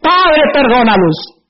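ordinary talk, nothing marked

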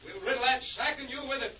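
calling from outside a shack. Acted.

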